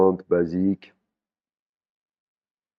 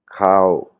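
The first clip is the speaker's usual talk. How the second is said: "Cow" kept short at normal pitch, louder; short pronunciation; 6 dB louder than usual. Shown as a demonstration. Teacher contrasts meanings of similar words, short "Cow". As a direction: cut "Cow" short without lifting the pitch. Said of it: "prononciation courte".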